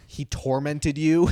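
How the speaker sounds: imitating an appalled mom, loud and drawn out; serious alarm. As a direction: raise your voice slowly in mock maternal outrage. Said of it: "took it very seriously".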